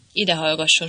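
plain reading voice

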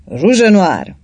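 loud rallying battle cry; command shout. A narrator's reading. The count draws his sword and shouts to his men, loud. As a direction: call it out like an order to charge. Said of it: "kiáltotta a gróf".

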